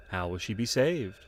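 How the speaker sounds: ordinary talk, nothing marked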